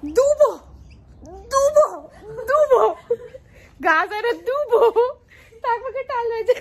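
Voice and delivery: high laughing shouts. Real, not acted. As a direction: burst out laughing while correcting.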